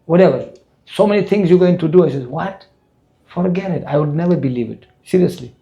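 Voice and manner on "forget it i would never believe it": ordinary talk, nothing marked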